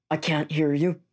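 ordinary talk, nothing marked

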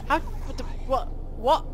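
high, loud startled questions; shocked disbelief. Real, not acted. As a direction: blurt out in shock. Confused panic after scare.

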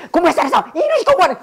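very high, screechy-mom telling-off; mock mom scolding. Mom scolding her children about studying, mock-furious. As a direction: scold the kids, pitch shooting up. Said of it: "애들 막 혼내킬 때".

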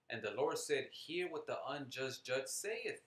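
slow, emphatic verse reading; scripture reading. Acted. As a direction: proclaim the verse, stretching the key words. Reads scripture aloud to listeners.

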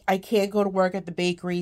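normal talking voice

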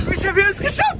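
excited, high and bright; jubelnd. Real, not acted. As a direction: cheer with relief. Triumph after the swim. (aufgeregt jubelnd)

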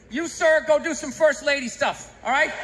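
ordinary talk, nothing marked

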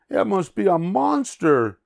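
high-pitched alarmed pig yell. Acted. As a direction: cry out high as a panicked pig. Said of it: "yelled Pig".

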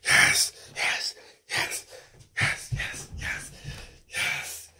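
breathy whispered cheering, slow at first; silent inner celebration. Acted. A kid hiding his joy from mom, whispering yes. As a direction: cheer under your breath so nobody hears.

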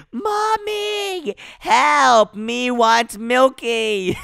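high, drawn-out mock wail; crying baby villain. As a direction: whine slowly like a baby begging mommy. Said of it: "he should start crying for his mommy".

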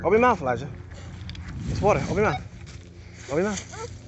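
dad loudly insisting his son Elijah drink the water. Real, not acted.

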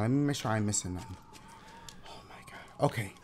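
hushed, breathless whisper. Real, not acted. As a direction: whisper it so no one hears. Sneaking around quietly in game.

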